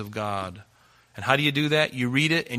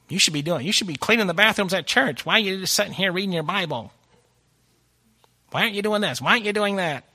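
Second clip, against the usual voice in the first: high, hammed-up scolding churchgoer voice; mock a nosy critic, whiny and preachy; nagging critic; 8 semitones higher, faster than usual. Mocking a judgmental church member who scolds you.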